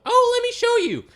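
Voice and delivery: very high, fast, sugary fake-sweet voice; sugary waitress. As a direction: trill it like a too-eager waitress. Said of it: "so the waitress was like".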